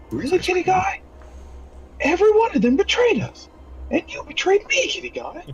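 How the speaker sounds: very high, squeaky put-on voice; betrayed character voice. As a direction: play wounded betrayal in a tiny falsetto. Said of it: "I'm just like".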